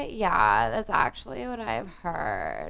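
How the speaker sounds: slow put-on vocal fry; mock creaky Valley drawl; crackly voice. Shown as a demonstration. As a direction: let each word drag and crackle. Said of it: "like, crackle like that".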